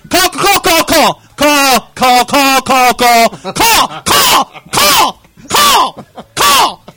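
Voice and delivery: high, very loud repeated shouts; frantic yelling. Retold or imitated. Driver screaming at voice dialing, frantic high shout.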